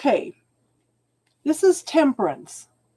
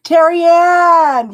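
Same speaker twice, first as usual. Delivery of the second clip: calling out; 5 semitones higher, 7 dB louder, slower than usual. Real, not acted. Calling out a viewer's name.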